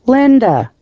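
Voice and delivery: high call, sliding down on LINDA. A narrator's reading. James calls to Linda from the kitchen, calling out. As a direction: holler the name from another room. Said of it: "James called out from the kitchen".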